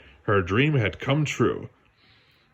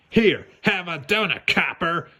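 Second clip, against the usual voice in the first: nasty sneering weasel; 6 semitones higher than usual. Acted. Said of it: "with a nasty laugh".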